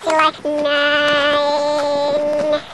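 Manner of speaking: slowly drawn out, each word stretched; very slow speech. Shown as a demonstration. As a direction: talk as if in slow motion. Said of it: "Hyvin hitaasti". (hyvin hitaasti venyttäen)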